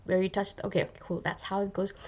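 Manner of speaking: soft muttering as if taking notes; hushed, studious self-talk; muttered notes to self. Pretend. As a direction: quietly sound out the notes to yourself.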